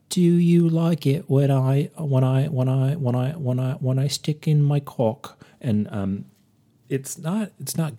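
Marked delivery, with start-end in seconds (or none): 0.1-5.4 loud, high fake British swinger; Austin Powers. Host mimicking the leering photographer, hammed-up Austin Powers voice. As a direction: stretch it out, then stumble through in mock British. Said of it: "it's really Austin Powers".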